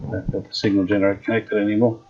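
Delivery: ordinary delivery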